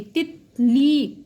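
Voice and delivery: तितली said very slowly, each syllable stretched; teacher stretching तितली for children; drawn-out demo word. Shown as a demonstration. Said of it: "ई का sound clear होगा".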